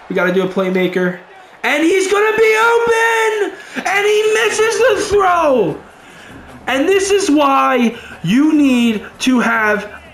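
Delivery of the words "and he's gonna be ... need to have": very high, slow, shouting then wailing; anguished shout. Real, not acted. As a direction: cry out as the throw sails wide. Open receiver then missed throw.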